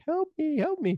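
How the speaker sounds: put-on high, squeaky "help me" in a pleading girl voice; hammed-up falsetto cry for help; mock damsel plea. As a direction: play the helpless girl calling out, way up high. Her mock plea in retold scene.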